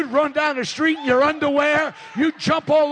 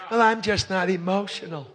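mock churchgoer excuse; 4 semitones lower, 4 dB quieter than usual.

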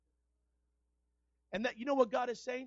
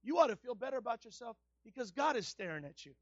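raised preacher voice easing down; impassioned preaching; 3 semitones higher, 10 dB louder than usual. Acted. Preacher assuring the congregation of God's love, raised preaching. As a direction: keep the fire, a little calmer.

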